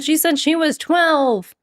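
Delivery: high, slow mock quote; mimicking the video. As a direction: joke the video line about the dog. Joke quote about the dog.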